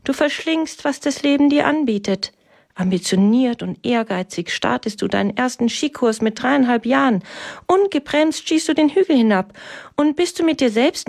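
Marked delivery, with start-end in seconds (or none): none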